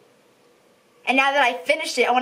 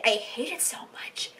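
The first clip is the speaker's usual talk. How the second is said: whispered, softer I hate it so much; whispered hatred; 9 dB quieter than usual. Real, not acted. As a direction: hiss out your hatred. Hatred for book.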